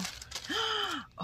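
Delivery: high soft gasp; delighted gasp. Real, not acted. Touching soft silk.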